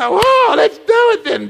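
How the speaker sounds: high, loud, hammed-up "oh, let's do it then"; mock lustful cry. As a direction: play the tempted man caving in. Acted sinner giving in to temptation.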